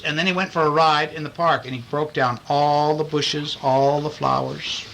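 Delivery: ordinary delivery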